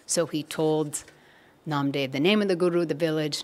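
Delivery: usual speaking voice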